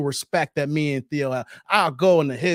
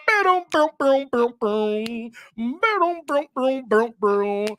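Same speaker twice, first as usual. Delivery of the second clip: vocal drum beat; 5 semitones higher, slower than usual. Pretend. Playing a drumroll joke beat.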